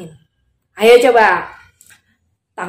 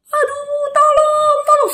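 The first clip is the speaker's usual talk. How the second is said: very high thin wail; drawn-out acted cries; crying out for help; slower than usual. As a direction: wail for help as a panicking crowd. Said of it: "Semua orang teriak".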